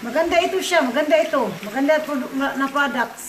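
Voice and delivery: loud, high, eager praise of the product; excited sales pitch. Real, not acted. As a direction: sell it with big enthusiasm to viewers. Urging viewer to try product. (masiglang pagbebenta, malakas)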